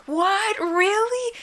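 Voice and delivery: put-on high, thrilled little girl voice; a child's sudden awe at a queen; excited kid. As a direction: squeal it like an amazed child. Kid's excited reaction.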